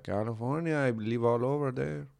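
put-on soft Mexican-accented cab driver; retold driver in a quiet Mexican accent; imitated Mexican accent, softly. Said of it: "the quintessential Mexican accent".

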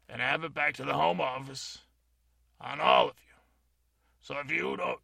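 loud, commanding break it up; loud, bossy inspector threatening demerits; crowd control. Acted. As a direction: order the crowd back to their drinks. Player breaks up staring crowd loudly.

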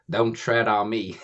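slow mock country drawl; thick country accent. Counsellor walking round in a slogan hat, country accent. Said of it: "So I'd walk around going".

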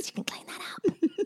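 whispered taunt, high and soft; sly whisper. Retold or imitated. Mum taunting secretaries about the soggy carpet, sly whisper. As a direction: hiss it slyly to the secretaries.

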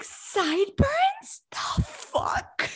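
very high, slow incredulous squeak, trailing into a whisper; mock incredulous beautician. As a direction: play the beautician baffled. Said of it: "And she was like".

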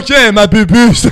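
booming presenter voice for pillow talk; sweet nothings at sportscaster volume; Alexandre Ruiz. Pretend. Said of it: "t'imagines Alexandre Ruiz dans la". (voix de présentateur tonitruante)

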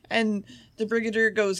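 ordinary talk

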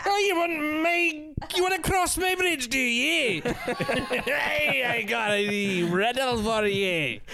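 high, giggly troll taunting. Pretend. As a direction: play a cackling bridge troll. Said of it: "giggling in the corner like a wee troll".

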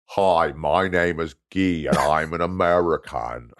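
slow, lightly faked American accent from a German; a German trying an American accent, slowly; bad American accent. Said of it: "he would love doing an American accent".

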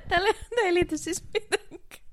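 words squeezed out through giggles; high giggly apology; laughing. Real, not acted. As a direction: try to talk while cracking up. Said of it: "mä oon naurattanut koko tää aika".

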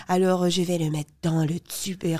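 a light put-on French accent from France. Shown as a demonstration. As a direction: play it lightly French, Quebecer doing a Parisian. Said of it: "je me prends un petit accent français".

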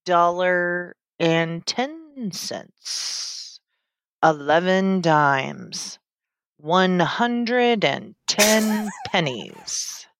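auctioneer dragging every word with long pauses; slow auctioneer. Acted. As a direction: auction it off at a crawl, pausing between words. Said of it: "I'm the world's slowest auctioneer".

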